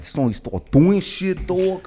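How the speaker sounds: teasing New York accent imitation. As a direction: mimic him with an exaggerated New York accent. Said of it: "I heard a little New York accent".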